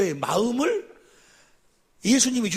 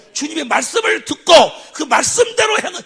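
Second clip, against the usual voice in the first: higher, louder, shouted declaration; preaching shout; 7 semitones higher, 11 dB louder than usual. Real, not acted. Preacher shouting to his congregation, full force. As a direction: drive the word home to the church.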